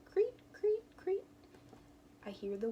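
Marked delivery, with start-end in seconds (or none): 0.1-1.2 very high chirping creep creep; playful high cricket sound. Retold or imitated.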